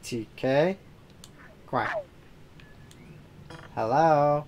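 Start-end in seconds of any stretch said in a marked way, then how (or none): 1.7-2.0 squeaky quack. Acted. Raccoon answers only quacking.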